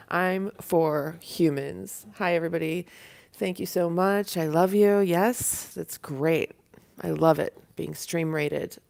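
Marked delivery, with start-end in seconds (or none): none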